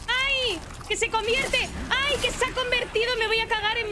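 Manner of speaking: high-pitched real alarm; pánico en el juego. Real, not acted. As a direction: yelp in panic as the monster turns. Susto al convertirse el enemigo. (susto y pánico, con voz aguda)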